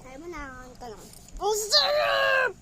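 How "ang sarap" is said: slow, high, loud "so good!"; excited shout. Acted. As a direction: shout it out in delight. Exaggerated reaction to spicy noodles.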